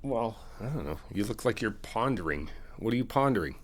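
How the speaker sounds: normal talking voice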